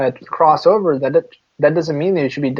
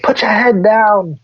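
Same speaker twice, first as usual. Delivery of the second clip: higher-pitched mock telling-off; mock scolding; 6 semitones higher, 3 dB louder than usual. Retelling being told off at school, mock scolding. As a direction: scold like an imitated authority, drawn out. Acting out people scolding students.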